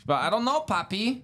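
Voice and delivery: very high put-on voice; woman impression. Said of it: "now you're going off a little bit".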